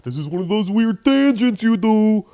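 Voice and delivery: high, loud put-on whine; mock complaining listener. Audience complaining about his tangents, mock high whine. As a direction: mimic an exasperated audience member.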